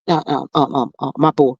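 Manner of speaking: normal talking voice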